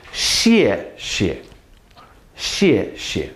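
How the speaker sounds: 谢谢 said falling then softening; correct Mandarin. Shown as a demonstration. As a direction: model the right 谢谢. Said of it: "Not shui shui, not shi shi".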